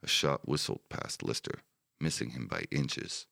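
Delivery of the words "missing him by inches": plain reading voice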